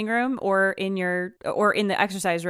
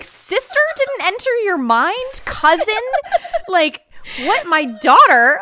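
high, loud, slow disbelief through laughter; she's stunned and amused at once; incredulous outburst; 12 semitones higher, 10 dB louder, slower than usual. Retold or imitated. Retelling her shock at the mover, high loud laughing disbelief. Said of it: "I was like".

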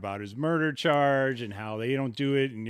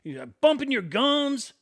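screaming taunt; 11 semitones higher than usual. Retold or imitated. Little friend screaming at the drunk to act, taunting. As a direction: scream a dare at a bigger man. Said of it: "He goes".

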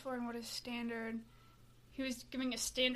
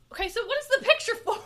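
surprised; 9 semitones higher, 11 dB louder, faster than usual. Real, not acted. Reacting to odd last meal photo.

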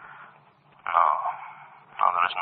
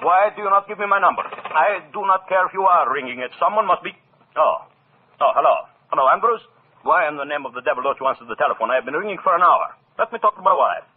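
higher, louder, furious demands into the phone; angry phone shouting; 6 semitones higher, 4 dB louder than usual. Acted. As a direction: berate the servant down the line. Furious phone call to servant.

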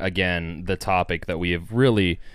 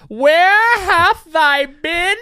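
high, slow, hammed-up shout; mock-archaic bellow; 11 dB louder, much slower than usual. Host greeting the returned traveler, mock-archaic bellow. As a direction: bellow it like a hammy medieval player.

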